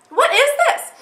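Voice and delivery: sharp high alarmed question; suddenly spotting strangers outside; what on earth; startled. Real, not acted. People coming down her driveway.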